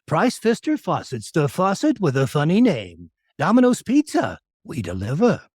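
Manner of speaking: strange commercial voice with big swoops in pitch; Andre Stoica. Retold or imitated. Imitating a rival voice actor's ads, strange swooping voice. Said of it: "He had a real strange way of talking".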